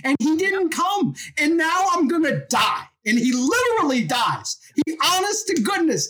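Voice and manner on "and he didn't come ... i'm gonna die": pitched higher, aggrieved and let down; hurt complaint. Retold or imitated. Lazarus on his deathbed complaining that Jesus never came, hurt and higher. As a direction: voice a dying friend feeling abandoned.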